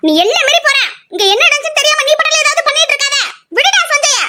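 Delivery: high-pitched, heated telling-off; angry warning. Acted. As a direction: warn him off, voice pitched right up. Heated quarrel, warning to back off.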